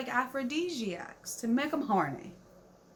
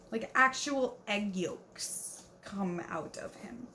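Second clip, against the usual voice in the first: whispered aside; 3 semitones lower, 5 dB quieter, slower than usual. Real, not acted. Grossed-out aside about the book.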